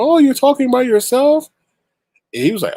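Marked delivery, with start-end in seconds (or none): 0.0-1.5 high, louder chirpy stranger impression; imitating a lady striking up a chat. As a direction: put on a high voice for a friendly lady.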